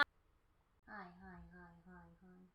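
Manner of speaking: very soft distant hi's; barely audible, fading; mock faint greeting. Real, not acted. Very quiet repeated hi.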